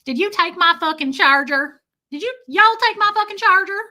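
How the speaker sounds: loud, high Southern twang; Southern accent. Acted. Walking up to a stranger, hammed-up Southern accent. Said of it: "Can you imagine walking up to someone and being like".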